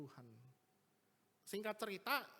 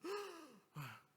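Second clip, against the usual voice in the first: a high, thin gasp for air, like a dying patient; imitated gasping breath; 11 semitones higher than usual. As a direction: gasp in the way of someone fighting for breath. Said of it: "napasnya itu udah Senin Kemis".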